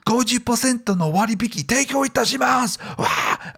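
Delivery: high, hyped sales pitch with a cheer; shopping channel host. Retold or imitated. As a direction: sell it fast like a TV host. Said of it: "Yeah, right".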